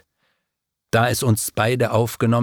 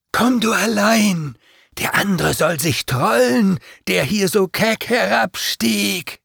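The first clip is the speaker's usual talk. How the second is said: demons' taunt; 10 semitones higher than usual. A narrator's reading. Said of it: "meinten sie".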